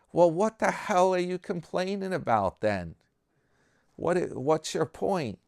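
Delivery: high, incredulous mock complaint, lightly acted; exasperated old guys. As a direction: throw up your hands at the bragging friend. Other old guys exasperated at him.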